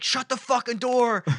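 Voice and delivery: high, loud barked order; retold cop shout. Imitating cop outside his cruiser, shouted order. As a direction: imitate an irate officer commanding. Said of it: "the cop's already out of his cruiser".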